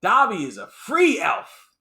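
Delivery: loud, slow defiant elf shouting back; defiant Dobby. Retold or imitated. Dobby defying Bellatrix, a loud slow furious shout. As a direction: stand tall and yell who you are. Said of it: "And Dobby's like".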